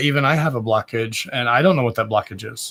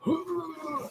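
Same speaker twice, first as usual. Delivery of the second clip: gasp; 10 dB quieter than usual. Real, not acted. Reacts to a stink.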